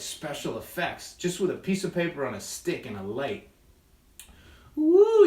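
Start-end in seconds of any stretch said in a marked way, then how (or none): 4.8-5.3 high, loud happy whoop; excited woo. Real, not acted. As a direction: whoop with delight. Excited shadow effects.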